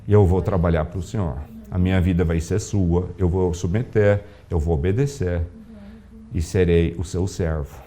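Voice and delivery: hushed surrender to the Lord; prayer of surrender. Retold or imitated.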